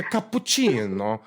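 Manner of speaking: hammed-up fake Italian accent; annoying Italian accent. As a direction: imitate a showy faux-Italian order. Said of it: "they do that very annoying".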